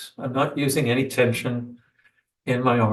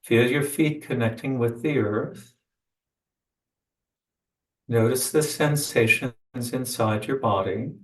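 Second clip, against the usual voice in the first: guided meditation. Acted. Leads a meditation.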